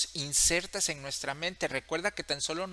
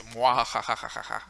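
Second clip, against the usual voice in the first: mustache twirling villain; 3 semitones lower than usual. Retold or imitated. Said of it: "escena maléfica con todo ese humo y dijese".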